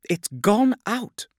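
high, loud and slow distressed announcement; extremely upset. A narrator's reading. Navigator breaking news that the beacon is out, upset. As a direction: let distress lift and stretch the words. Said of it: "She was also extremely upset".

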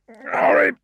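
loud, strained through gritted teeth. A narrator's reading. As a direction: force it out through clenched teeth. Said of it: "through gritted teeth".

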